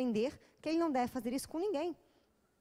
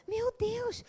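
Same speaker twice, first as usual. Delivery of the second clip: shocked friend; 10 semitones higher, 3 dB louder than usual. Retold or imitated.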